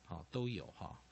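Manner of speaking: ordinary talk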